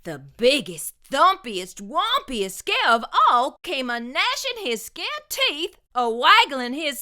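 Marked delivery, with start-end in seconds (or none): none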